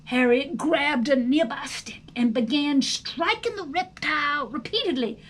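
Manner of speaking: usual speaking voice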